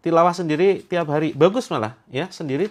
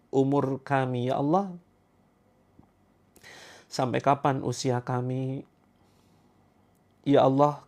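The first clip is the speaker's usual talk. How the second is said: slow, earnest prayer voice with long pauses; leading a prayer. Acted. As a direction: plead to God slowly, drawing out each phrase. Leads a group prayer. (doa yang khusyuk dan pelan)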